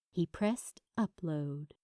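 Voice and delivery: plain reading voice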